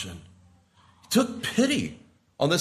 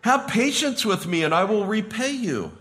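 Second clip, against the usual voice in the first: pleading slave; 8 semitones higher than usual. Retold or imitated.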